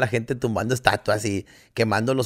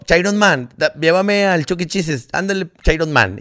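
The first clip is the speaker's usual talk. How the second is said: imitating a nagging little kid, higher and louder; niño exigente; 5 semitones higher, 5 dB louder than usual. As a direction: pester dad like a cheeky youngest son. Imita al hijo chiquillo pidiendo salir. (niño insistente, imitado con voz más aguda)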